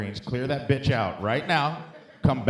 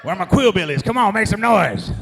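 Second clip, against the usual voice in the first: loud rising shout to rally the crowd; hype man call-out; 9 semitones higher, 9 dB louder than usual. Acted. Comic hyping the NyQuil fans in the crowd, shouting. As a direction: work the room like a hip-hop MC.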